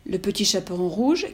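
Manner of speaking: ordinary delivery, nothing marked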